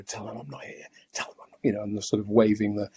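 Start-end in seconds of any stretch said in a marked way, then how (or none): 0.0-1.6 barely voiced, much softer aside; whispered aside so the caller can't hear; mimed whisper. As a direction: hush it as if miming to your partner. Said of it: "that miming sort of".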